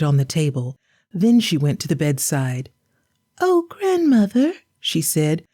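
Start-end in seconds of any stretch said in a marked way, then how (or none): 3.4-4.5 put-on high, sweet child voice; a small girl calling grandmother; little girl voice.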